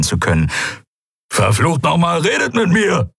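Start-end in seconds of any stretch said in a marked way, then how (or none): 1.3-3.1 higher, shouting angrily; blinded Kero yelling at the others; angry shout. Acted. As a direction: demand an answer, furious. Kero angry, cannot see.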